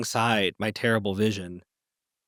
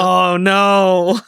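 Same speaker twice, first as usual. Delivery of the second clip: loud dismay; 7 semitones higher, 11 dB louder than usual. Real, not acted. About to be outed.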